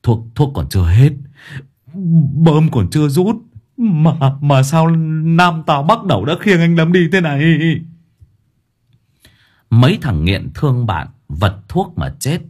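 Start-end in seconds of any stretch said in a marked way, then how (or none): 0.0-7.6 stammering, high-pitched sobbing lament; louder wailing. A narrator's reading. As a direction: bawl in hammed-up grief, stretching it into a wail. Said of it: "thằng khác chu chéo to hơn".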